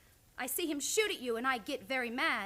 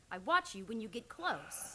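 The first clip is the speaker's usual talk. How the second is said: ordinary talk, nothing marked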